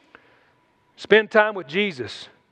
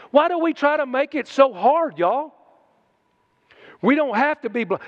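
usual speaking voice

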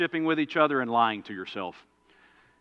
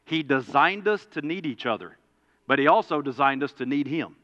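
ordinary talk, nothing marked

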